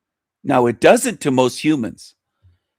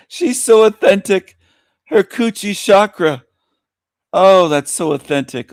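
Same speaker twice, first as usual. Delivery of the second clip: higher, louder snide gushing; sarcastic mock praise; 7 semitones higher, 5 dB louder than usual. Real, not acted. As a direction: keep up the fake admiration. Continues mocking guest.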